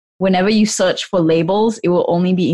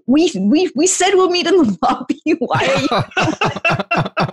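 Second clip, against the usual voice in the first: high-pitched, stammering bafflement; mock exasperation; 8 semitones higher, slower than usual. Friends waiting in the lobby, misled by lift, mock exasperation. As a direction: pitch it up, incredulous and half-laughing.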